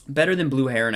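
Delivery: ordinary talk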